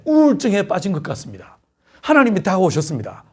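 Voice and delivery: ordinary talk, nothing marked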